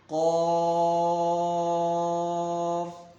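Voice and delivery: قاف loud, heavy, held very long; thick long madd. Shown as a demonstration. Teacher models five-count stretch, قاف thick and sustained. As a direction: chant قاف with rounded vowel, steady note. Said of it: "پانچ الف کھینچیں گے".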